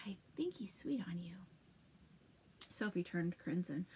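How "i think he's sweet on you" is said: stage whisper. Acted. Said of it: "held up a hand to the side of his mouth".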